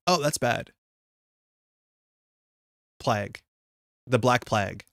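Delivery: ordinary delivery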